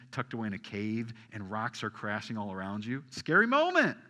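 ordinary delivery

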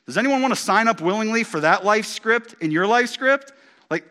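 normal talking voice

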